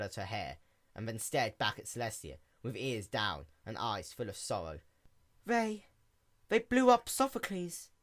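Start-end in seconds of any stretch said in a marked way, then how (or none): 5.4-7.9 high, stammering pitiful voice; pitifully. Acted. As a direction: plead it like a hurt child. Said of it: "Luna said pitifully".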